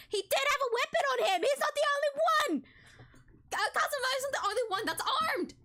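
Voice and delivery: high-pitched, loud yell of alarm; outraged yelling. Acted. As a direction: shout it in a high, frantic voice. Player erupts over the revealed weapon.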